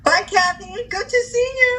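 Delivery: high, loud sing-out farewell; cheery goodbye. Real, not acted. Co-host signing off to Kathy, cheery high goodbye. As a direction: call it out high and bright to a friend.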